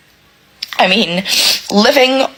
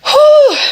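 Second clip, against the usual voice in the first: loud high emotional exhale; shaky exhale; 5 dB louder than usual. Real, not acted. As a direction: blow out to hold back tears. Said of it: "I'm getting really emotional".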